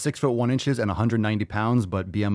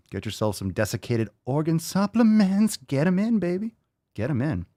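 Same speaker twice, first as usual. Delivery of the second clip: much higher, pumped-up sales pitch; hype-man push; 8 semitones higher than usual. Real, not acted. Hyping the listener.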